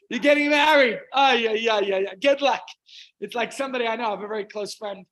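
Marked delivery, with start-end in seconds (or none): 0.1-2.6 very loud mocking cheer; hammed-up, booming well-wishes; mock congratulations. Mocking congratulations to the newly married.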